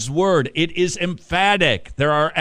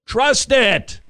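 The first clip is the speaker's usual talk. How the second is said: much higher and louder, pressing it home; urgent loud plea; 9 semitones higher, 6 dB louder than usual. Acted. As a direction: Trust it! hammered as a cry to believe. Repeats Trust it, building up.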